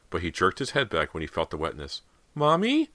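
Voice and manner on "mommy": put-on scared child. A narrator's reading. Sobbing boy calls mother.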